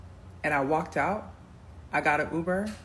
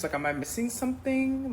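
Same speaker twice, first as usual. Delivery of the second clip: high, puzzled question; genuinely lost, asking up high; confused; 9 semitones higher than usual. Retold or imitated. To her date about the water, high confused question. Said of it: "I'm just like".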